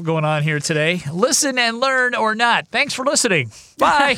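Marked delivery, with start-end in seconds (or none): 1.1-4.2 mock radio sign-off. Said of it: "mock sign-off, higher voice".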